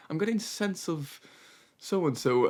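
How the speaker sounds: imitating an earnest wine taster; wine connoisseur. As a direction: savour it like a wine snob. Imitates American guy tasting wine.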